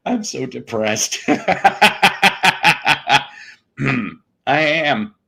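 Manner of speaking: mock-depressed with a long cackle; laughing mock gloom. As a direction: claim misery, then burst out laughing. Claims depression, breaks into laughter.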